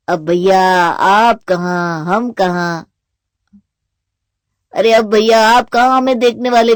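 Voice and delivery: slow, loud, drawn-out teasing; mock lament. Younger brother teases newlywed elder brother, exaggerated drawl.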